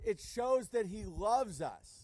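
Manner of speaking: ordinary delivery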